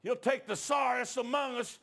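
high, slow shouted declaration; loud pulpit shout, words stretched out; full-force preaching. Real, not acted. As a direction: cry out the truth to the congregation.

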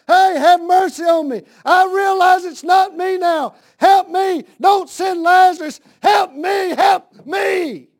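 high, loud, shouted begging; desperate plea from hell. Pretend. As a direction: cry out for help like a damned man. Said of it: "It seems to me like he'd have been".

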